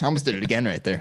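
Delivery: ordinary talk, nothing marked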